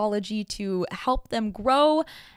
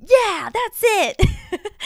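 much higher and louder, slow and laughing; fiery excitement; 11 semitones higher, 7 dB louder, much slower than usual. Retold or imitated. Cheering on her friend's plan to wear bright colours, excited and laughing. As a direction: burst out with delighted fire. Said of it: "My fire came out".

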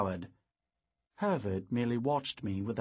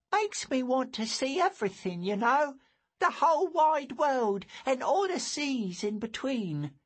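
put-on higher boyish voice, wistful and eager; a wide-eyed boy dreaming of the world; dreamy young lad; young boy Lou; 12 semitones higher, 3 dB louder than usual.

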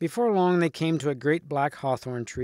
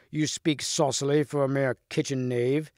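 usual speaking voice

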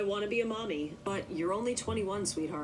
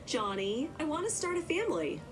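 put-on girlish plea; 9 semitones higher than usual. Narrator voices her own plea.

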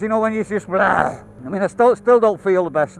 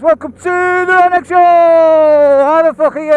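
showman intro; 7 semitones higher, 7 dB louder than usual. Acted. YouTube intro greeting, showman voice.

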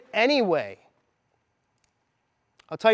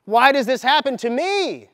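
higher, slow hammed-up whining; mock self-pity; 4 semitones higher, slower than usual. Mocking the self-pity he chose not to feel, slow and whiny. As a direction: play a pitiful victim, tongue in cheek. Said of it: "I could have said".

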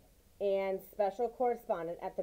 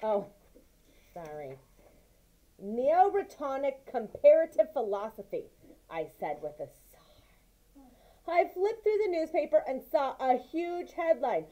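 lively, raised read-aloud voice for the mouse tale; story narration; 6 semitones higher, 7 dB louder than usual. A narrator's reading. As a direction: tell it like a bedtime story, with energy.

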